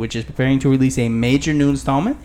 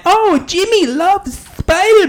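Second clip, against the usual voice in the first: put-on a Walmart mom in a New York accent, pitched way up; New Yorker mom. A mom at GameStop asking for Spider-Man, high New York accent. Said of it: "talk like a New Yorker".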